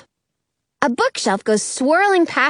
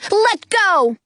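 yelling; 8 semitones higher than usual. Acted. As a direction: shout it down at him. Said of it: "I yell down at Gluck".

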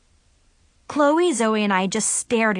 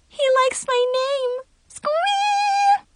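very high giddy squeal; squealing with delight; much slower than usual. A narrator's reading. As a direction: squeal like a starstruck fan. Girl gushing over crush.

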